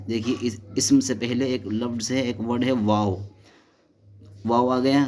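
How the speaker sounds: ordinary delivery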